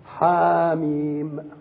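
حاميم stretched very slow, syllable by syllable; teaching reading, slow and deliberate; slow drawn-out reading. Shown as a demonstration. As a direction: draw out حاميم slowly like a recitation. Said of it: "دي بداية الحواميم".

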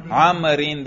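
عمرین pronounced "amrin", with an -in ending; jarr ending. Shown as a demonstration. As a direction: end عمرین on a clear "-in". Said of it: "اس کے جر پہ تلفظ کرو".

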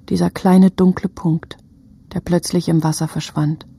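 usual speaking voice

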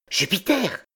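high, contained call. A narrator's reading. Stranger hailing the dazed actor, calling out. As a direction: call to get his attention.

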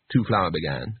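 ordinary delivery

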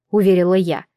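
ordinary delivery

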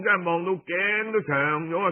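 plain reading voice

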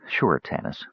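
quiet gentle reply; soft. A narrator's reading. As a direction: say it softly to him. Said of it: "Tika said softly".

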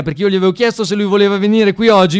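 normal talking voice

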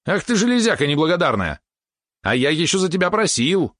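high-pitched scolding; indignant outburst. A narrator's reading. Valeron rebukes the ungrateful robot, high indignation. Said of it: "взвился Валерон".

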